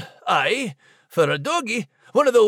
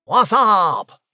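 casual slang; 2 semitones lower, 5 dB louder than usual. Acted. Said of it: "did you just say".

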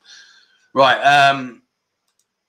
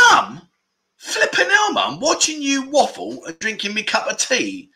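high-pitched playful telling-off of mum; hammed-up betrayed son scolding his mother; jokey outrage, much higher; mock indignant; 8 semitones higher than usual. Real, not acted. Mock outrage at mum's comment.

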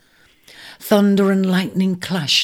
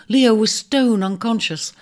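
usual speaking voice

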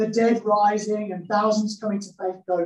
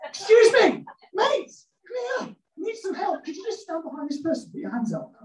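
calling out; 10 semitones higher than usual. Retold or imitated. Calling a catcher over.

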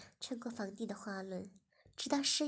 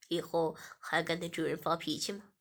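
low, louder threatening voice; stern master; 6 semitones lower, 3 dB louder than usual. Acted. Master interrogating Shiya, low and menacing. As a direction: lean in and demand an answer.

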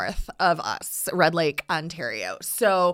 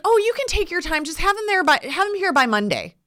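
casual coach; 9 semitones higher, faster than usual. Retold or imitated. Coach casually giving a short deadline.

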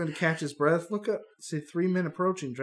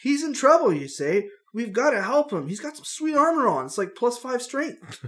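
put-on raised, eager young adventurer; hero voice; 7 semitones higher, 5 dB louder than usual. Hero urging Stacy to stay, eager raised voice. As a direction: talk the hero into it, keyed up.

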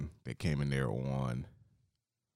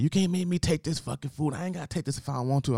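much higher, louder indignant yell; mock angry customer; 11 semitones higher, 5 dB louder than usual. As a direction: hurl it back like an offended thug.

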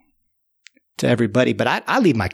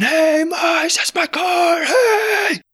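shouting after them; much slower than usual. Retold or imitated. Owner on the bike yelling at the mice with his car.

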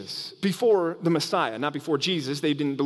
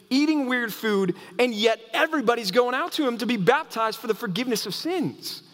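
rising preacher's fervor, higher pitch; lively sermon delivery, pitched up; preaching; 5 semitones higher than usual. Acted. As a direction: build the excitement as you preach. Preaching builds intensity.

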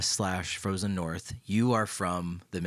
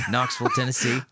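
louder, higher Tennessee accent; Southern accent; 5 semitones higher, 5 dB louder, faster than usual. Shown as a demonstration. Native showing off the hometown, Southern accent. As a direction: turn on the Knoxville accent. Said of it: "I can turn it on".

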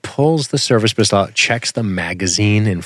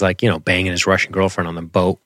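usual speaking voice

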